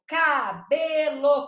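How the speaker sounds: "cabelo" drawn out slowly as ca... be... lo; syllable by syllable. Shown as a demonstration. Teacher counting the pieces of "cabelo", slow separated syllables. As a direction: stretch each piece of "cabelo", loud and slow. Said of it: "Quantos pedaços tem?".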